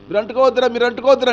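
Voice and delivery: put-on father begging children not to touch; frantic shout. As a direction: yell మీరు అంటుకోవద్దురా in panic. King pleads harder.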